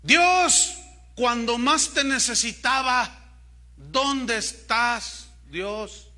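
loud, high, slow cry to God; acted desperate mother crying out; anguished shout. A narrator's reading. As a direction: wail it out word by word. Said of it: "Dios gritó".

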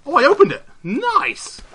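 high, loud burst of excitement; thrilled, pitched right up; delighted surprise. Real, not acted. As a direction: exclaim at an unexpected success. Delighted that it opened.

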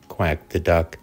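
plain reading voice

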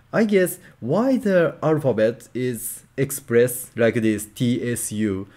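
normal talking voice

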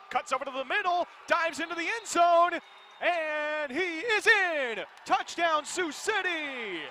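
high, loud stadium shout; touchdown call. Real, not acted. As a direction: yell the touchdown, then the score.

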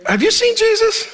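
high-pitched worried question, held up at the end; worried mother. Acted. Mother realizes child is missing.

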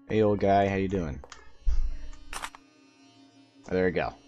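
usual speaking voice

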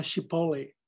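"Chipotle" garbled as shi-POH-lay; anglicized pronunciation. Shown as a demonstration. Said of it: "no Chipotle".

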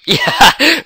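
sudden high crack on "Yeah". Real, not acted. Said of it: "Voice Crack City".

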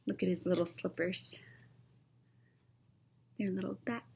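hushed aside about the picture; quiet aside. Real, not acted. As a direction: murmur it as a side comment.

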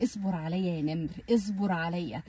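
low, soft conspiratorial whisper; hushed whisper. Real, not acted. Wife whispering her prank plan to the camera, hushed. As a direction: keep it hushed so he can't hear.

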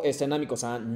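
ordinary talk

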